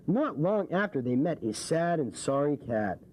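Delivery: usual speaking voice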